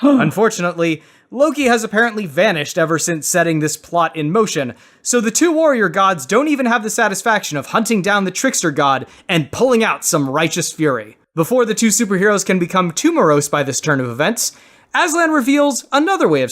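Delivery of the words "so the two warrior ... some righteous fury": ordinary talk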